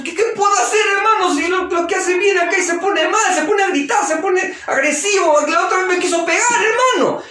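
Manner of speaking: high, anxious pleading; a distressed mother begging the preacher for help; desperate mother. Retold or imitated.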